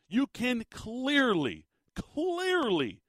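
CLEARLY drawn out slow, high then falling; emphatic insistence. Real, not acted. Host insisting what the video shows, emphatic stress on CLEARLY.

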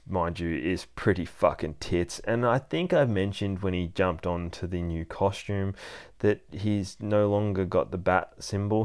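ordinary talk, nothing marked

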